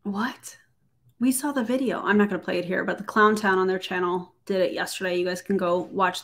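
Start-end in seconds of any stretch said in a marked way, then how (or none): 0.0-0.6 breathy unvoiced what; whispered disbelief. Real, not acted. Commentator whispering in disbelief at the story. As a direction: whisper it in shock.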